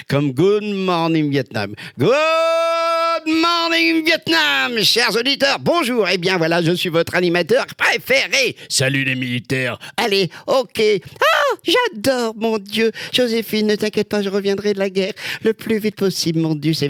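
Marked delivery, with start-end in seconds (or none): 2.0-6.1 much higher, slow, stretched-out on-air greeting; radio DJ wake-up call. Retold or imitated. Lookalike shows off his film voices, high stretched radio call. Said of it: "j'arrive à faire des voix". 6.1-16.4 raised, showy on-air patter; radio DJ impression. Lookalike imitating the film's radio host, high showy patter. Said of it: "j'arrive à faire des voix".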